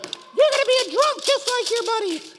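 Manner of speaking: very high screechy accusation; hammed-up nagging wife; mock wife voice. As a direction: scold him in a piercing falsetto. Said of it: "She's like".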